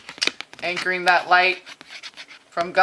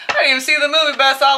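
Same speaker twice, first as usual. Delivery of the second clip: usual speaking voice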